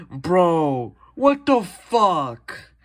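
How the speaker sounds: loud, high, drawn-out "what the fuck"; exasperated yell. Real, not acted. Gamer cursing his luck at the screen, slow loud exasperation. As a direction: stretch it slowly in loud disbelief. Rare find was wrong variant.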